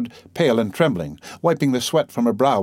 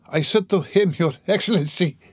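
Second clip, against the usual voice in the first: higher ejaculation with a touch of gasping; lightly gasping; 7 semitones higher than usual. A narrator's reading. Delirious woman recalls addressing His Excellency, lightly gasped ejaculation. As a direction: blurt it high, catching some breath. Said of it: "gasping after each word".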